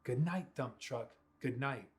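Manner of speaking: plain reading voice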